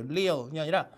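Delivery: ordinary delivery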